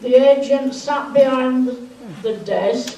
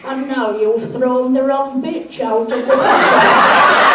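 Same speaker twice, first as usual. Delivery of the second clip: laughing through punchline; 2 semitones higher, 7 dB louder than usual. Real, not acted. Punchline of his own joke.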